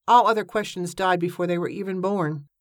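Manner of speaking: plain reading voice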